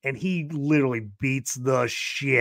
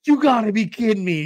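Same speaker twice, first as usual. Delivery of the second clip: a higher, louder exasperated outburst; annoyed disbelief, hammed a little; mock pissed; 7 semitones higher, 5 dB louder, faster than usual. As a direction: play him fed up at the knife. Character finds knife, pissed.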